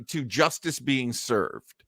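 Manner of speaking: ordinary delivery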